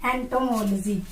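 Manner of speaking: एंटोमोलॉजी as ento-MOL-jee; corrected pronunciation. Shown as a demonstration. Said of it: "क्या बोलेंगे?". (एंटोमोलॉजी को एंटोमोल-जी बोलकर)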